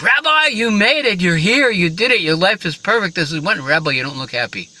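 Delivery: exultant shout to a new arrival; jubilant Lord. Acted. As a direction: cry out a joyous greeting, easing off at the end. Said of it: "the Lord said".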